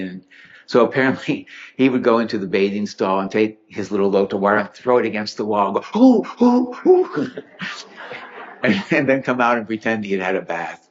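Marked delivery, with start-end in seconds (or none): 6.0-7.3 high, hammed-up shivery ooh cries; mock cold yelps. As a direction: yelp like cold water just splashed you. Pretends cold water hits him.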